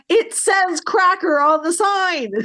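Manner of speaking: high, drawn-out comic protest; mock exclamation. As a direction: exclaim the punchline, high and slow. Punchline retort, laughing through it.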